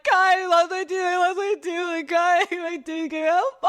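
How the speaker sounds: high, loud hysterical wail; screaming bloody murder. Retold or imitated. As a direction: imitate a sobbing, shrieked phone call for help. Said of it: "I'm screaming bloody murder on".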